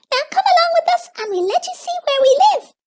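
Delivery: very high, fast little creature; squeaky creature voice. A narrator's reading. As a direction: chirp it out like a tiny forest creature. Gleeks speak.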